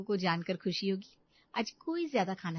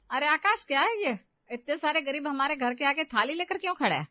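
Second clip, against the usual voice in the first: loud, higher alarmed question; shocked demand; 4 semitones higher, 5 dB louder than usual. Acted. Mother returns home and demands an explanation from son, loud. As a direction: call out to son in disbelief.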